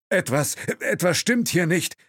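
high, halting call with stumbling words; calling out haltingly. A narrator's reading. Chauffeur calls out in broken English, high and halting. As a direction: stumble over the words in alarm. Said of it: "rief der Chauffeur in holprigem Englisch".

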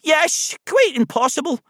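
high, loud Scottish teddy bear; high-pitched Scottish character voice; Scottish accent. A narrator's reading. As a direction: agree brightly in a Scottish accent. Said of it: "a voice with a soft Scottish accent".

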